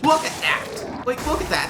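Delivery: high, slow, incredulous "look at that"; exasperated disbelief. Real, not acted. To viewers, mocking the map designers' tease, exasperated and incredulous. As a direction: point it out like you can't believe it. Scoffing at a pointless map feature.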